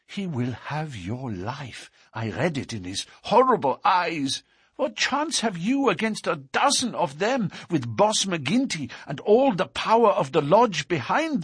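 high-pitched, anxious woman's voice; fearful warning. A narrator's reading. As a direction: warn a sweetheart in dread. Frightened sweetheart warns of danger.

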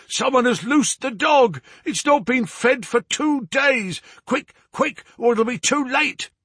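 very high, alarmed character voice; acted panic, sustained high pitch; panicked cry. A narrator's reading. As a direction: cry out an urgent warning way up high. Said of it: "he cried".

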